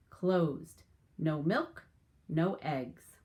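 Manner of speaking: lively storybook read-aloud; animated picture-book narration; reading to children. Acted. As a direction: tell it to a class of young kids.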